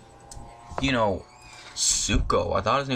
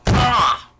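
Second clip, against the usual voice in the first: sudden shriek; 10 semitones higher, 8 dB louder than usual. Real, not acted. Sudden outburst mid rant.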